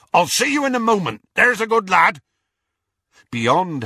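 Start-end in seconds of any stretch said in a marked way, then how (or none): 0.1-2.2 quick, high, loud call; bellowing. A narrator's reading. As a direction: holler a fond goodbye through a window. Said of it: "he bellowed through the hole in the window".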